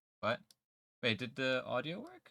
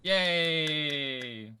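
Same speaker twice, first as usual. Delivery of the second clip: cheering; 3 semitones higher, 5 dB louder than usual. Acted. Alert sound cheers.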